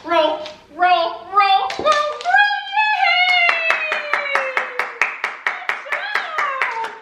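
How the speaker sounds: excited high dog-praise voice; building dog cheer. Real, not acted. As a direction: cheer the dog on until it rolls. Cheering dog through roll trick.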